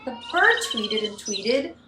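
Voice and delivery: loud lively narration; loud chaos. A narrator's reading. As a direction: build the chaos up. Chaos at home third time.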